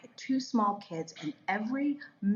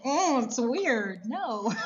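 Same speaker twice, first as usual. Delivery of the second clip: drawn-out, higher mock protest; reluctant protest; 4 semitones higher, 5 dB louder, much slower than usual. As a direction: balk at it loudly, half laughing. Acted protest to God's prompting.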